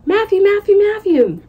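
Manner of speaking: high, rapid repeated call; yelling a name. A narrator's reading. Host father calling over the heads at the airport, yelling his name. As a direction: hail someone eagerly over a crowd. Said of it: "who was yelling my name".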